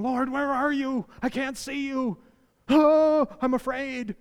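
high, loud, hammed-up cry of fear; mock frightened plea. Preacher acting a believer crying out to God, high and fearful. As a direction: play a scared believer calling out to God. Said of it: "we're going".

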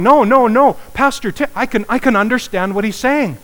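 high, loud eager "No, no, no"; excited protest. Retold or imitated. Ari standing up in church, excitedly vouching for the pastor. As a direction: jump up insisting you understand. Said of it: "he'd be going".

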